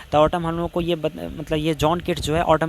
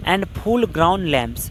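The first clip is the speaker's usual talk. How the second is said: very slow quoting of a verse phrase; slow classroom recitation of a poem; much slower than usual. Shown as a demonstration. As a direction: drag the phrase out word by word. Teacher repeats poem phrase.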